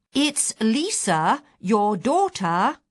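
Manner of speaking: very slow, loud, spelled-out reminder; patronising slow reminder. Retold or imitated. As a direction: talk as if she's stone deaf. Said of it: "outer Mongolian and stone deaf".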